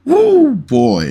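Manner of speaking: high, loud woo of disbelief; excited whoop. Real, not acted. Podcaster reacting to a rigged blackjack clip, excited whoop. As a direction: let out a stunned whoop.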